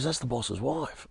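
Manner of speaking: slow, soft, hushed warning. Retold or imitated. A mate warns Matty who he just swore at, hushed and slow. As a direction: let it land slowly under your breath.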